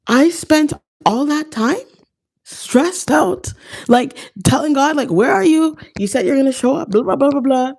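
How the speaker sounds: mock-whine at God like he's late; whiny complaint. Retelling her complaint to God, high whiny mock voice.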